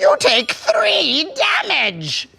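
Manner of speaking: lightly strained monster voice; a hint of the ridiculous game villain; obnoxious character voice. Player dealing damage to opponents, in a mildly obnoxious character voice. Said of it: "get that voice out of here".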